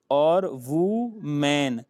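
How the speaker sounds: "वू मैन" split and stretched, woe man; slow, stretched "वू" then "मैन"; drawn-out joke reading. Shown as a demonstration. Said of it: "woo for man".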